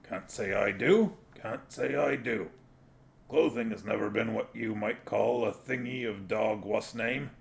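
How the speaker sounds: a hint of a gruff little dog, mock-shocked; lightly voiced dog Gaspode. A narrator's reading. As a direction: Gaspode feigning prudish dismay, lightly voiced.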